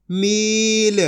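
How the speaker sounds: मील with a held, level ī; long drawn-out vowel. Shown as a demonstration. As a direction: stretch मील into "meeel". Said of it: "मील पढ़ेंगे खींच के".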